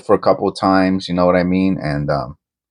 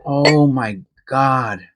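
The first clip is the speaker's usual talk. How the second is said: high, very slow, stretched; awed disbelief; 6 semitones higher, much slower than usual. Real, not acted. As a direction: breathe it out in awe. Marveling at penny.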